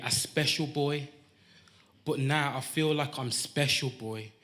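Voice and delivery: measured, rhythmic performance poetry; spoken-word poem. Acted. Poet tells his birth story to the crowd, spoken-word delivery. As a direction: ride the rhymes, setting each phrase apart.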